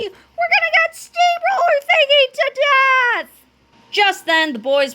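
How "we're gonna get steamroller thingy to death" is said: terrified scream. A narrator's reading. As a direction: draw out a cartoon scream of doom. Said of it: "screamed Fluffy".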